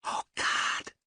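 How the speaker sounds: soft unvoiced gasp of dread; whispered. A narrator's reading. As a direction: whisper it in terror. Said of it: "she whispered".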